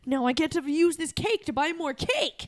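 put-on delighted kid. Kid with coin from cake, gleeful. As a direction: crow over the money found in cake. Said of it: "You're like".

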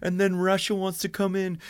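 high, delighted piling-on; excited riffing. Acted. Writers getting on board with a pitch, excitedly riffing. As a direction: build on the idea eagerly.

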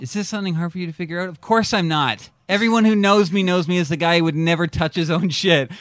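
high, loud, fast indignant protest; mock indignant. Real, not acted. Answering the vengefulness question, loud mock outrage. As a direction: protest like the question insults you. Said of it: "He's so mad".

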